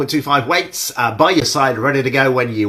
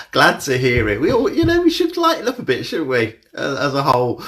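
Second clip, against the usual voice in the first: mock Aussie, pitched way up; a hammed-up bad Australian accent; appalling Australian accent; 3 semitones higher, 4 dB louder than usual. Greeting viewers in an awful mock Australian accent. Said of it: "it's an appalling Australian accent that was".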